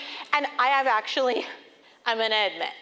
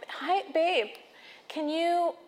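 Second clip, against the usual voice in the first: soft, sweet mom voice to her son; a soft, careful plea to a little boy; trying to be nice; 6 dB quieter than usual. Retold or imitated. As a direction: coax him gently and softly. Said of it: "I'm trying to be so nice".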